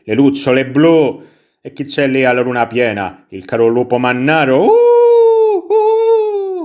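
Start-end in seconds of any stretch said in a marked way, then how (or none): 4.6-6.6 long, very high wolf howl; mock werewolf howl. Player greets the werewolf in the moon, high mock howl. Imita il lupo mannaro sulla luna.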